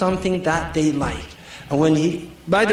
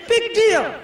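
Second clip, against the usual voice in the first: mocking shrug; 14 semitones higher than usual. Husband's view, mocking.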